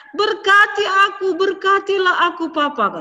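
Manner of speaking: high, loud tearful begging; crying plea. A narrator's reading. Esau begs his father for a blessing, crying. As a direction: beg through tears. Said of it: "Dia nangis".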